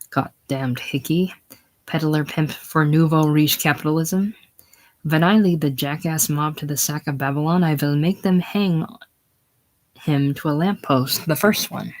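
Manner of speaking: ordinary talk, nothing marked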